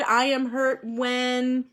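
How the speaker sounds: slow, drawn-out sentence starter; slow prompt. Shown as a demonstration. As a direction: stretch each word for the class. Teacher slowly repeating sentence starter.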